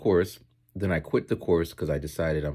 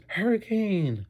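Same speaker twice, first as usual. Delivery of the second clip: put-on much higher, slow kid voices listing answers; imitating kids; 5 dB louder, much slower than usual. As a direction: mimic eager schoolkids, drawn out. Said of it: "And some kids".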